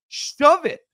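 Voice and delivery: "Shove it" snapped out loud and high; angry dismissal. Real, not acted. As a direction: tell him off with scorn. Rejecting player's contract demand.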